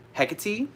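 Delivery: Hecate as HEK-uh-tee, stress first, pitched high and rising; wrong pronunciation. Shown as a demonstration. Witch quoting how the TV show says it, wrong pronunciation. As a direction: say Hecate ending in tee, lifted like a question. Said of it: "Hecate is the wrong way to pronounce her name".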